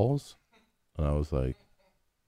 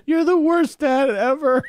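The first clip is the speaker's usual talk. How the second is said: put-on high, loud, drawn-out kid tantrum; hammed-up childish wail, stretched out; imitating yelling child; 5 dB louder, slower than usual. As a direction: yell it like an aggrieved little kid. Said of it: "yelled at me".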